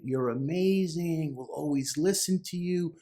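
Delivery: high-pitched mock fan praise; adoring fans. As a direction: imitate fans calling out to their idol. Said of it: "what is he hearing?".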